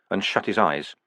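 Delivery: ordinary delivery, nothing marked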